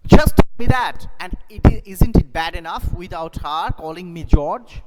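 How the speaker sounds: loud, high angry protest; angry George. Shown as a demonstration. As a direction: roar it at the wife. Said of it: "George angrily".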